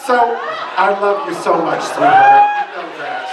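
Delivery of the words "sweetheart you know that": mock sweetheart. Mock-tender address to stepmother.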